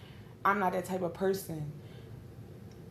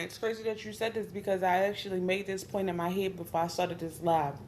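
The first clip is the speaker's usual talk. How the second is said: usual speaking voice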